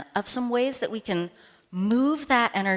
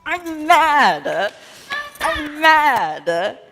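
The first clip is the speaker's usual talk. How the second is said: higher, louder bouncy I'm mad; jumping mock anger; 9 semitones higher, 8 dB louder, much slower than usual. Shown as a demonstration. Leader jumps the anger out for kids, loud, high mock anger. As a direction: hop the anger out with each word. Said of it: "you have to jump it out".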